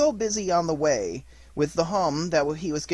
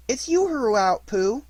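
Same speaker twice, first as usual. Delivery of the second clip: a touch higher, small creature voice; lightly voiced small animal; 8 semitones higher, 5 dB louder than usual. A narrator's reading. Piglet answers Pooh in his doorway, small voice. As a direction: chirp it like a little piglet.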